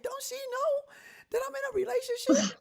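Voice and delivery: very high-pitched indignant outburst; exasperated retelling, voice shooting way up; still mad. Retold or imitated. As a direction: squawk it in mock outrage. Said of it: "You still mad?".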